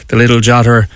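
normal talking voice

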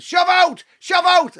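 high, forceful sideline holler; coach's shout. Retold or imitated. Coach to his young lad on the pitch, shouting. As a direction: imitate a Clare coach yelling orders. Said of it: "He's shouting to his young lad".

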